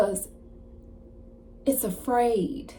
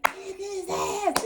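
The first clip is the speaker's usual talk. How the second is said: high, stretched mock cheer at no great volume; retold cheering; 12 semitones higher, slower than usual. Dude who bet on Leon, cheering him on. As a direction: egg the fighter on in a high, drawn-out voice.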